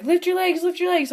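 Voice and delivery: high, repeated instruction, imitating the callers; urgent calling out. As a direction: call it up to someone flying in. Guys at landing calling out instructions.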